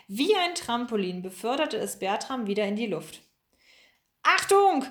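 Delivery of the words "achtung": high, loud call of alarm; warning shout. A narrator's reading. Pomme de Terre warns Bertram in the fight, urgent shout. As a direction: shout a warning across the room. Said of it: "rief ihm Pomme de Terre zu".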